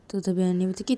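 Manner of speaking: ordinary delivery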